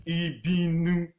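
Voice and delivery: Ibinu, drawn out slowly syllable by syllable; slow I-bi-nu, ending in -nu; Yoruba pronunciation. Shown as a demonstration. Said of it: "they want to pronounce it".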